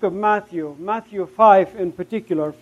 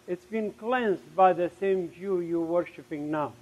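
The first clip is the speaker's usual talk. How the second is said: heart's quiet answer; 2 semitones lower, 7 dB quieter than usual. Retold or imitated. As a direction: answer the outburst in a hushed voice. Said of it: "my heart said".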